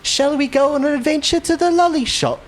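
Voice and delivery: very high, hammed-up dainty invitation; twee adventurer. Streamer mocking the cute lolly shop name, high twee voice. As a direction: put on a sweet, high storybook voice.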